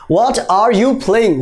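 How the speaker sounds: "What are you playing?" slow, separated; each word on its own; slow full form. Shown as a demonstration. Teacher gives the long form.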